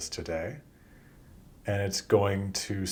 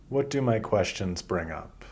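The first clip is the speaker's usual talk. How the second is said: ordinary delivery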